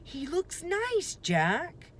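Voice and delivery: high, lightly voiced little sister; young girl voice. A narrator's reading. Annie telling Jack the dinosaur looks nice, lightly voiced girl. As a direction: coo it sweetly about the dinosaur.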